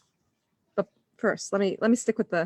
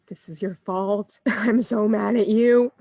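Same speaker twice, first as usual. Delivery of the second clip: mock angry; 6 dB louder, slower than usual. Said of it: "the first one sounded more like".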